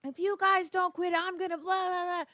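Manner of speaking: a hint of a fed-up parent screaming; scolding the kids, a lightly voiced mock shout; imitating yelling at kids. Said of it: "Screaming and screaming".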